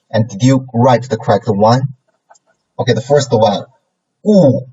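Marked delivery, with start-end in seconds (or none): 4.2-4.7 GU in a steep fall; fourth tone. Shown as a demonstration. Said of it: "is a fourth tone".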